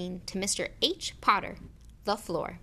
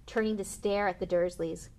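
usual speaking voice

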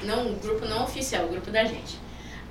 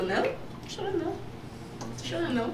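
higher, softer put-on denial voice; imitating Fabíola; 6 semitones higher, 10 dB quieter than usual. Mimicking Fabíola denying she was crying, lightly voiced. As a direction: play Fabíola denying the tears in a light mock voice. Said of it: "Tá chorando, Fabíola?".

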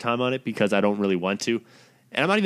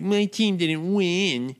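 whiny mock protester; 5 semitones higher, much slower than usual. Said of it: "and you're like".

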